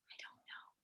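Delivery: tiny whispered admission, retelling being stumped; stumped near-whisper. Retold or imitated. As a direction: barely voice it, like a student with no answer.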